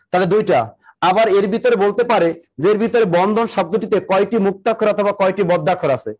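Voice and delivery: ordinary talk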